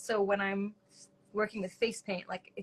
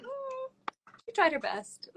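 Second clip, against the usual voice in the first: pitying; 13 semitones higher, slower than usual. Pretend. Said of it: "it's like".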